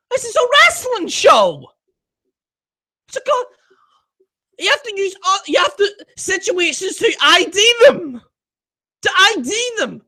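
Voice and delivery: high-pitched, exasperated protest; exasperated rant. Real, not acted. Exasperated rant over banned wording.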